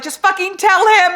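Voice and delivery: high, loud exasperated yell; frustrated yell at character. Retold or imitated. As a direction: shout it at the book's hero. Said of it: "and I'm like".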